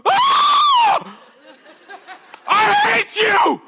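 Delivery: high, full-throated scream of rage; furious mother screaming. Retold or imitated. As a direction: scream it out, drawn out and very loud. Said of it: "And she flipped out".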